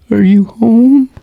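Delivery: high, loud, drawn-out mock panic; imitating alarmed caller. Caller asks if he's home, imitated high panicky voice. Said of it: "she was like".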